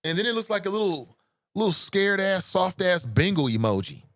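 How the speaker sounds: ordinary talk, nothing marked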